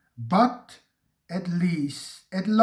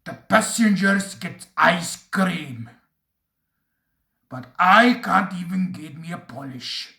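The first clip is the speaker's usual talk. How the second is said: sulky engine voice. A narrator's reading. Duncan complaining.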